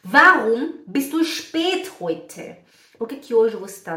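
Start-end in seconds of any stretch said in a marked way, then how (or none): 0.1-2.6 pergunta lenta, aguda e forte; esposa questionando. Acted. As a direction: cobre devagar o marido pelo atraso. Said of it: "e você fala". (cobrança lenta ao marido)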